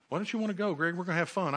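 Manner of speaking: buddies' voice. Retold or imitated. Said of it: "my buddies wanted to go party".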